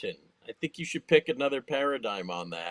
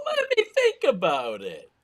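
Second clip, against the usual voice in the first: mock deliberation; 7 semitones higher, 4 dB louder than usual. Mocking the movie's drawn-out dilemma.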